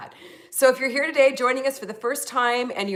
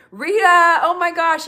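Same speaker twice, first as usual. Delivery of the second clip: excited; 8 semitones higher, 8 dB louder than usual. Real, not acted. Recognizing a friend in chat.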